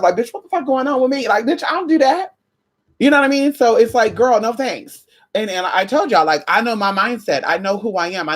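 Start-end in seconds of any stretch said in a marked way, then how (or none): 0.1-2.3 higher, incredulous inner voice; mock self-alarm. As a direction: catch yourself in disbelief. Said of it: "you're asking yourself".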